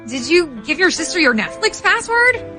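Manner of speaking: normal talking voice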